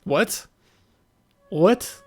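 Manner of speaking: ordinary delivery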